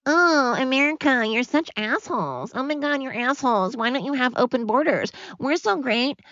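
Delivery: hammed-up high-pitched whiny mockery; mocking Canadian critic. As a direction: sneer it like a smug neighbour lecturing America. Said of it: "And then Canada's like".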